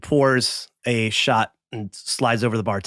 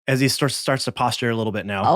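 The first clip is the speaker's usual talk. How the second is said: usual speaking voice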